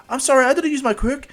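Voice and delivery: put-on high, apologetic girl voice. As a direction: blurt an apology in a high girlish voice.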